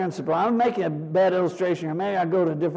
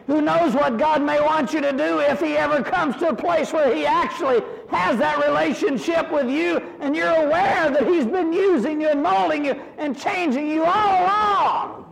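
fervent preaching; 8 semitones higher than usual. Acted. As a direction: lift it to a ringing peak. Preaching climax.

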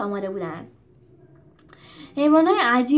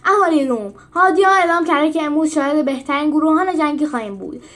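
loud, higher proclamation; announcer; 5 semitones higher, 7 dB louder than usual. A narrator's reading. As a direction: call it out to the whole arena. Referee announces to spectators.

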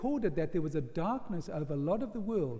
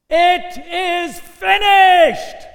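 huge, slow, drawn-out shout, much higher and louder; triumphant shout; 10 semitones higher, 18 dB louder, much slower than usual. Acted. Preacher voicing Jesus' final cry from the cross, triumphant shout. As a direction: roar it out with everything left. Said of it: "he gathers his strength and he cries out".

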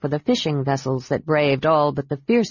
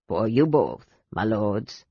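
put-on old sailor offering gifts to the knights, gummy and humble; toothless old sailor; 7 semitones lower than usual. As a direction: mumble like a toothless old seaman. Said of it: "gumming the words".

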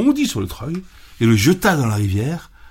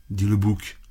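usual speaking voice

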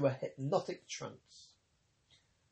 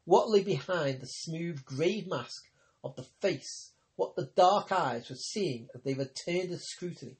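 usual speaking voice

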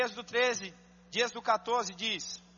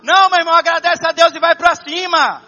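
loud, higher, fervent sermon climax; full-force preaching; 4 semitones higher, 11 dB louder than usual. Acted. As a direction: preach it out to the whole church. (pregação inflamada, em alta voz)

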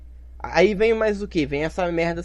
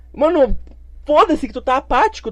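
angry outburst; 7 semitones higher, 3 dB louder than usual. Real, not acted. Ranting at an apathetic friend.

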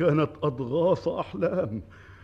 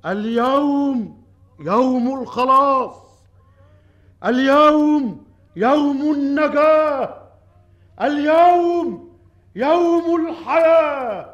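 royal proclamation; 5 semitones higher, 5 dB louder than usual. Acted. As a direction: declaim it to the whole crowd. King proclaims to the people.

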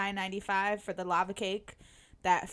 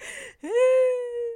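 high, loud tearful gasp; emotional gasp; 13 semitones higher, 5 dB louder than usual. Retold or imitated. Fan reliving the moment he popped on screen, gasping. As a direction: gasp like the idol just appeared. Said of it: "I was like".

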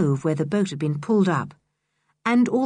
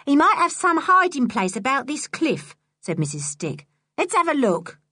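high sharp woman voice; 7 semitones higher than usual. A narrator's reading. Missus Stick voiced.

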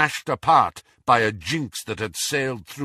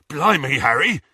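slightly raised, breathless exclamation; panting; 3 semitones higher, 4 dB louder than usual. A narrator's reading. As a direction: puff it out, winded and excited. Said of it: "panted Hagrid".

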